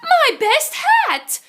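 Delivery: high, loud, slow cry of dismay; a lady wailing over her hat. A narrator's reading. Mrs Spooner crying out as her hat flies away. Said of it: "she cries".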